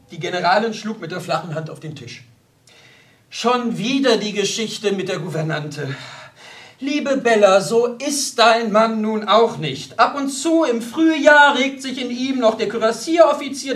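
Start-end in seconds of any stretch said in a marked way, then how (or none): 3.3-13.8 loud, raised, emphatic; impatient mother setting her daughter straight; exasperated rebuke. A narrator's reading. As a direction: cut the complaint short forcefully. General's wife bangs table, rebukes daughter.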